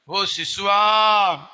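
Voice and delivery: loud, very drawn-out prophetic call; proclaimed call. Acted. Zechariah prophesying to his newborn, called out long and loud. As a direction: call out to the child, holding the last vowel. Acts Zechariah addressing the baby.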